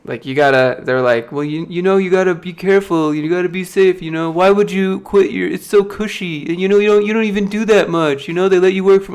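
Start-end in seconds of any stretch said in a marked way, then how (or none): 1.3-9.1 mocking friends. Friends telling him his job is too cushy to quit, whiny mockery. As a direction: parody nagging friends, a touch sing-song.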